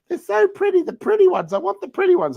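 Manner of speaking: high, gushing imitation of his wife; mock girly whine. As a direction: gush over the pretty roosters like a dreamy city girl. Husband imitates wife's wish.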